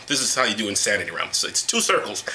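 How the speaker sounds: ordinary talk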